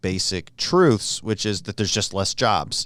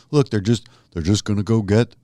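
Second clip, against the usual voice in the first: John Kerry voice; 2 semitones lower than usual. Retold or imitated. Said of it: "I'm trying to do a John Kerry voice".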